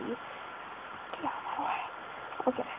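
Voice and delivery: slow, hushed whisper at an obstacle; whispered grumble. Real, not acted. Gamer whispering at a duck blocking his path. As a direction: breathe it out, annoyed.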